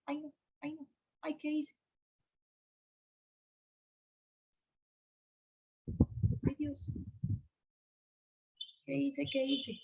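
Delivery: soft, fretful "ay, no" under her breath; quietly panicking at a slip; dismayed muttering. Real, not acted. Screen tool fails mid-class. (murmullo angustiado)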